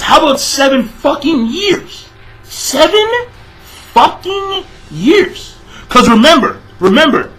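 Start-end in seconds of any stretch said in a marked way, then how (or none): 0.0-5.5 incredulous. Real, not acted. Mocking an exaggerated timeline claim.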